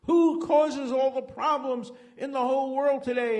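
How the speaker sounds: high, loud ranting speech cry; shouting demagogue at a rally; Hitler screaming. Retold or imitated. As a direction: bellow the question to the crowd. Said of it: "screams out".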